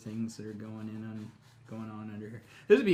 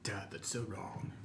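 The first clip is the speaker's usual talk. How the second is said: slow, soft mock disapproval; a daughter gently scolding her dad, hushed and drawn out; softly put-on reproach; imitated daughter; 5 semitones lower, 11 dB quieter than usual. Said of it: "Our daughter would be like".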